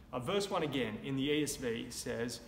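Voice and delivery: ordinary delivery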